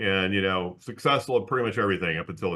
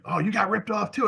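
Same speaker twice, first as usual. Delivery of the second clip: surprised; 11 semitones higher than usual. Retold or imitated. Retold caller's surprised question.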